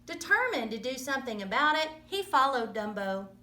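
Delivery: ordinary delivery, nothing marked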